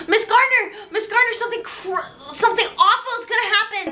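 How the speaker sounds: high, urgent pleading; hammed-up alarm; frantic warning, half laughing; mock panic. Sue warns teacher.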